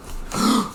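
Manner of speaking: sharp acted intake of breath; waking gasp. Boy waking in the dead of night, startled gasp. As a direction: gasp as if jolted awake.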